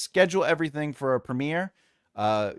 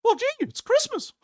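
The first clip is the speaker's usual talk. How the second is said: high squeaky cartoon mouse, louder; Mickey Mouse voice; 6 dB louder than usual. Streamer greeting chat on Christmas in a high, loud mouse voice. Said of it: "what is this Mickey Mouse bullshit".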